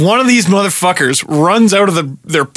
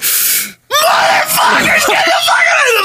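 high, strained scream, slow on the first word; screaming at the attackers to run off; furious scream; slower than usual. Retold or imitated. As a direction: scream them off as loud as possible. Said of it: "I just screamed at them as loud as I could".

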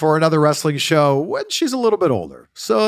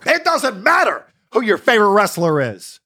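higher, louder wrestling-promo cadence; The Rock impression; 8 semitones higher, 4 dB louder than usual. Shown as a demonstration. Dad doing The Rock's catchphrase cadence. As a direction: put The Rock's swagger on it. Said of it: "not a great impression, but the cadence".

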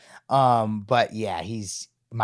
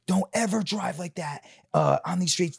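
imitating angry dad; 6 semitones higher than usual. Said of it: "punches him square in the face".

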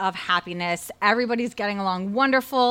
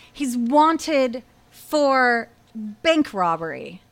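very slow, with suspenseful gaps; dramatic slow reveal; much slower than usual. Retold or imitated. Storyteller keeps reading the paper aloud, slow suspenseful reveal. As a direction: draw each part out to land the shock.

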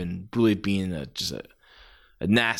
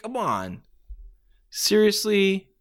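slow, higher incredulous "Seriously?"; exasperated; 8 semitones higher, slower than usual. Real, not acted. Fan fed up with the finish, slow disbelief. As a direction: let the disbelief hang.

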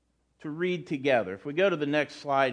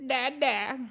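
imitating a baby's first word, higher; a light baby voice, higher; baby talk; 8 semitones higher, 4 dB quieter than usual. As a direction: coo it like an infant saying Dada. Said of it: "Little babies go".

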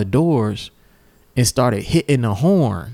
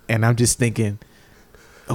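ordinary delivery

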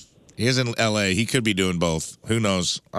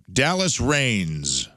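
slow announce; much slower than usual. Acted. Host reads quiz name dramatically.

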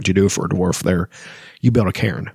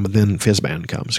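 normal talking voice

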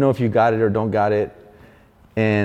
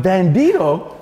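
high, loud incredulous echo; hearing the silly name, a high stunned echo; 8 semitones higher, 4 dB louder, slower than usual. Retold or imitated. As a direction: repeat the name in disbelief. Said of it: "And I'm".

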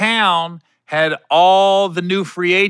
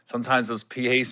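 ordinary talk, nothing marked

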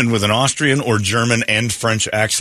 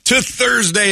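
big show opener; slower than usual. Real, not acted. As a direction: belt out the morning welcome. Radio host's big show opener.

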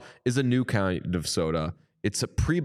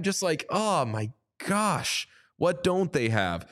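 slow, higher gush of amazement; 6 semitones higher, slower than usual. Real, not acted. Host gushing over soda flavors to cohost, slow and high.

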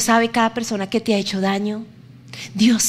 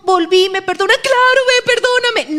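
high, light mock-pleading Agar voice, much higher and louder; mock sweet apology; 10 semitones higher, 4 dB louder than usual. Preacher mocks Agar's imagined sugary apology to Sara. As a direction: play Agar begging forgiveness too sweetly. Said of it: "no fue como".

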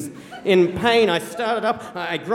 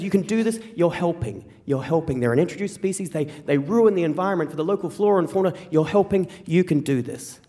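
ordinary delivery

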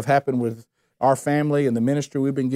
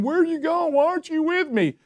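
much higher, frantic abandoned cry; panicked plea; 13 semitones higher than usual. Retold or imitated. Believer in calamity crying to God, panicked plea. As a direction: cry out as if God left you.

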